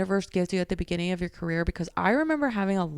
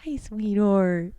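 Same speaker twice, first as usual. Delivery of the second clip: cooing to the cat; much slower than usual. Real, not acted. Talking to her cat.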